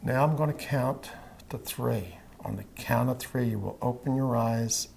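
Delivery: slow, soft, even hypnotist voice; hypnotic induction. Acted. Hypnotist guiding a trance.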